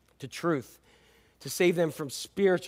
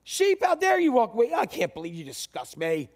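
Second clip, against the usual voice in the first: hammed-up disgust at a wandering sheep; mock scold; mock outrage; 7 semitones higher than usual. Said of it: "We need to say".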